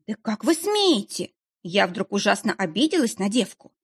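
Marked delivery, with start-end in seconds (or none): 0.1-1.3 indignant. Acted. Offended by being called девка.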